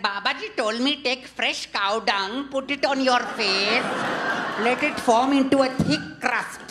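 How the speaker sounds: half-hearted grandma impression laying down the law; a hint of an elderly Indian lady advising; imitating Indian grandmother. Grandmother quoted giving beauty advice.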